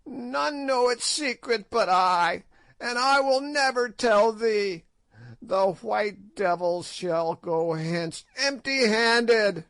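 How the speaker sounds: high crone voice, defiant and mocking; ancient witch. A narrator's reading. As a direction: play a spiteful ancient witch, gloating. Old witch Gagool voiced.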